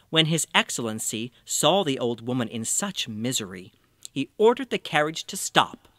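ordinary delivery, nothing marked

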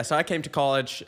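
ordinary talk

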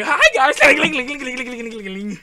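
loud, high jangling imitation; mock annoying jingle. Player imitating jangling earrings, loud and annoying. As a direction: shout a greeting, then rattle off clinks. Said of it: "that's gonna be annoying".

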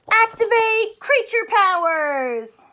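high, loud and slow battle cry; hammed-up cartoon catchphrase, loud and high; excited catchphrase. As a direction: call it out like a superhero transforming. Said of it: "Say it with me one more time".

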